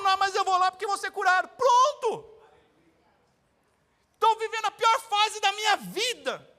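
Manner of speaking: loud, high fervent preaching; preaching at full force. Acted. Preacher urges the congregation to believe, loud fervent preaching. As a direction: proclaim it to the church.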